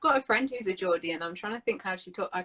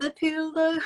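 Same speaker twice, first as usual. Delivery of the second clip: higher Scouse "Liverpool"; attempted Scouse; 9 semitones higher, 4 dB louder than usual. Correcting friend's word, with a light Scouse accent. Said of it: "I can't do a Scouse accent".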